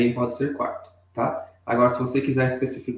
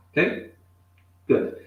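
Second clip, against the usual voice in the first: ordinary delivery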